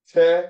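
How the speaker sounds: "te" as one short syllable, high and loud; demonstrated syllable. Shown as a demonstration. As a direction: punch out "te" on its own. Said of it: "And you pronounce that".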